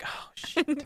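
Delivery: small, high, quiet mock dismay; hushed squeaky quote of an oath; high-pitched, soft acted quote; retold exclamation. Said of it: "and he's like".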